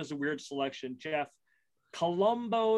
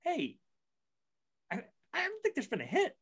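past self at work; 11 semitones higher, 4 dB quieter than usual. Retold or imitated. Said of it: "and I'm like".

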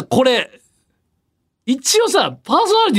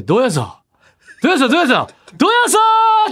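retold radio gag; 8 semitones higher than usual. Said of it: "お互いのギャグの応酬".

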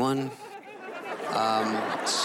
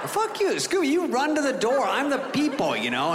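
mock scolding; 12 semitones higher than usual. Real, not acted. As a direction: tell the lazy dog off, hammed-up. Comic scolds the dog.

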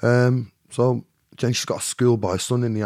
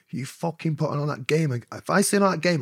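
rising, heated warning to a pimp; angry threat; 7 semitones higher than usual. Retold or imitated. Man on bike warns pimp, heated threat. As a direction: threaten him, voice climbing high.

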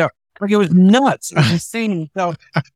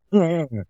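imitated heckler's single laugh from the back. Heckler at the back of the crowd laughing at the comic, imitated mocking laugh. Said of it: "some guy in the back of the crowd go".

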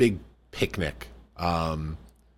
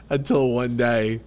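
ordinary delivery

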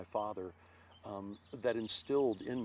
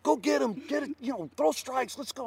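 much higher, louder rally shout; cheering teammates on; 14 semitones higher, 10 dB louder than usual. Retold or imitated. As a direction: holler at the pitcher to throw strikes. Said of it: "screaming at the top of my lungs".

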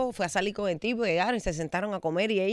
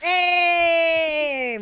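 excited shout; 8 semitones higher than usual. Real, not acted. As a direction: call out the hello. Greeting with a shout.

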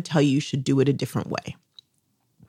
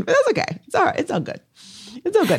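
soothing singsong; 11 semitones higher, much faster than usual. Acted. Consoling sing-song.

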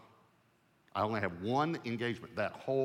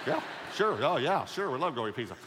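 much higher and louder, over-keen; eager yes; 4 semitones higher, 6 dB louder than usual. Retold or imitated. Broke preacher accepting a pizza invite, overeager. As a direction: jump at the free meal.

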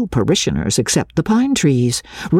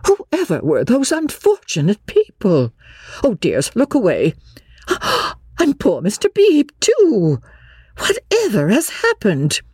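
shocked mother; 8 semitones higher than usual. A narrator's reading.

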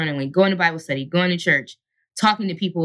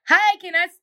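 higher, bright and polite phone greeting; chirpy agent voice; 9 semitones higher than usual. Retold or imitated. Agent calling her on the road, lightly voiced chirpy greeting. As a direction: play the cheerful agent on the phone. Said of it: "and she's like".

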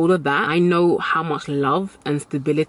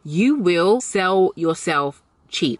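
very slow, deliberate repeat; emphatic warning; much slower than usual. Shown as a demonstration. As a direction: drag it out word by word.

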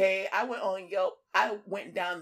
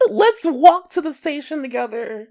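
high loud sweet invite; 4 semitones higher, 12 dB louder than usual. Pretend.

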